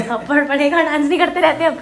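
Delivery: high, loud playful threat over music; loud teasing threat; joking shout. Real, not acted. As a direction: shout it as a joke.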